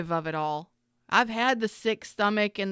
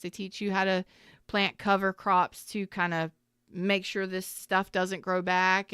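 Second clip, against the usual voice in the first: usual speaking voice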